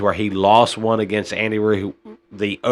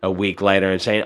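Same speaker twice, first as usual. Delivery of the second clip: ordinary talk, nothing marked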